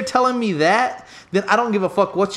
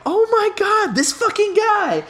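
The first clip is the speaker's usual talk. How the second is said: high, slow, exasperated groan at his friend; exasperated disbelief; 12 semitones higher than usual. Retold or imitated. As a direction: drag it out, fed up and amazed. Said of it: "and I'm like".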